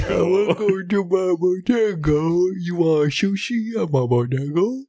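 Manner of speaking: bodega owner character. Acted. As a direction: welcome customers in an odd put-on voice. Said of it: "who runs a bodega".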